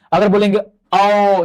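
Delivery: slow held ओ for pupils; drawn-out vowel ओ. Shown as a demonstration. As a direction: stretch ओ long. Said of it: "बस बोल के आप समझ जाएंगे".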